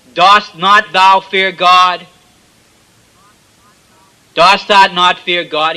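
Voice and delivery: loud, slow rebuke of the other thief; stern rebuke. Acted. Thief on the cross scolding his companion, loud and slow. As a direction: bellow it like a scolding preacher.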